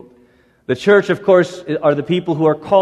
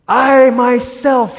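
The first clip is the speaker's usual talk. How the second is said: voice of God; 8 semitones higher, 5 dB louder, much slower than usual. Acted. God speaks to Moses.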